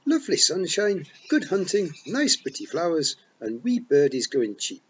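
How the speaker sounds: high, loud Scots pixie, full of pride; excited Feagle boast. A narrator's reading. Rob boasts to Tiffany about being born here, loud and proud. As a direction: wee warrior proudly telling his tale. Rob lists heaven's joys.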